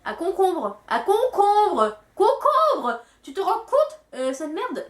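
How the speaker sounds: rising to an indignant squeal; outraged. Real, not acted. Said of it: "vous voyez déjà comment je m'énerve". (indignation qui monte)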